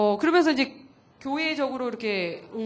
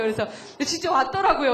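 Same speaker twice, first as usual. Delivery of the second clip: laughing, louder and higher; laughing delight; 4 semitones higher, 5 dB louder than usual. Real, not acted. Wife telling the audience the newcomer really came, laughing.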